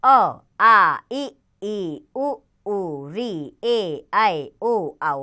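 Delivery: put-on each vowel said separately and clearly for children; vowel recitation. Shown as a demonstration. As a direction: call out each vowel with a pause, like a teacher. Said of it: "teacher reciting the vowels". (बच्चों को स्वर बोलकर सिखाना)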